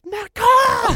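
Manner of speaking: loud, very high squeal of a name; starstruck yell. Retold or imitated. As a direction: reenact a kid losing his cool at a star. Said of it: "lose my cool".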